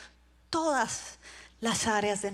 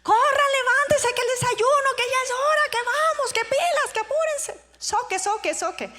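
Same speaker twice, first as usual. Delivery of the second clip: high, loud, harried yelling of orders; shouting mother; 12 semitones higher, 7 dB louder than usual. Retold or imitated. Mother hurrying her son every morning, high shouting. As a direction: yell rapid-fire commands in a high-pitched voice. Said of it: "era una señora gritando".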